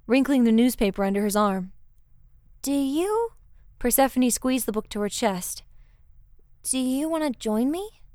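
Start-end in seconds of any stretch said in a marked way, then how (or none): 2.6-3.3 high, rising, unfinished question; tentative. A narrator's reading. Persephone about to invite Hades, tentative and high. 6.6-8.0 higher voice lifting into a question; tentative invitation. A narrator's reading. Persephone asking Hades to join her, tentative.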